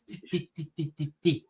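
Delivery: quick tongue-tip DI syllables; clicky, light DI, DI, DI; clicky New Zealand sound. Shown as a demonstration. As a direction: tap each DI on the tip of the tongue. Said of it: "sort of at the tip of the tongue".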